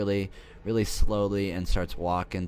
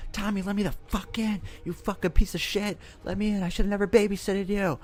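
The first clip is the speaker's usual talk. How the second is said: very high, panicked imitation; hammed-up shrieky pleading and cursing; mock frantic; 13 semitones higher than usual. Babysitter begs the boy to let her in, mock frantic. Lori frantic at the locked door.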